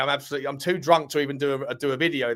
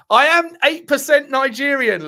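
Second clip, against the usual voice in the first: proud loud declaration; 10 semitones higher, 8 dB louder, slower than usual. Real, not acted. Proud announcement to viewer Luke.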